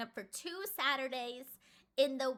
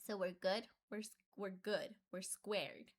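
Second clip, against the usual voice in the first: ordinary delivery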